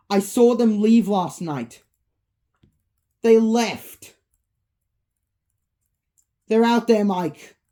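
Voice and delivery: high, loud frightened report; terrified. Acted. Vanessa warns Mike the animatronics escaped, terrified. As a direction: deliver the news in rising alarm. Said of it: "Vanessa's voice is terrified".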